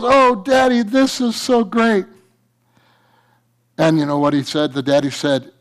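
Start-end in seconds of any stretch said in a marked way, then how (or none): 0.0-2.0 put-on high, slowed, joyful child voice; excited little boy. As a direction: gush with childish joy. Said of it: "And the son goes".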